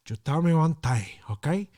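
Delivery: lightly voiced Scarface pushing a point; Scarface impression. Vlogger showing his old voiceover, lightly voiced Scarface.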